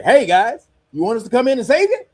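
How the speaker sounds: high, fast mock-friendly offer; mocking America. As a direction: sneer it like a smug helper.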